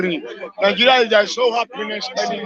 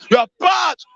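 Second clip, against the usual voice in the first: angry shout; 5 semitones higher than usual. Real, not acted. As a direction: hurl the insult at all of them. Shouting insults at politicians.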